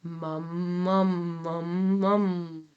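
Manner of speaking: soft, sleepy, speechy hum of "mum"; relaxed dopey spoken "mum"; soft dopey speech-like mum. Shown as a demonstration. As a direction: say "mum" like you're half asleep, softly. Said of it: "Say mum in a soft, dopey, speech-like voice".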